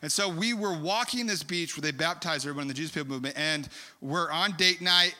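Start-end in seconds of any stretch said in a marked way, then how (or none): none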